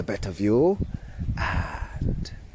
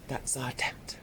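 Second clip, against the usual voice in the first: whispering; 15 dB quieter than usual. Acted. Whispered after showing tent.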